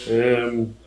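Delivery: slow, hesitant mock answer with a laugh; imitating the Southern guys' slow reply; mock puzzled reply. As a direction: voice the Southern guys, lightly, as if stating the obvious. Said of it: "They were like".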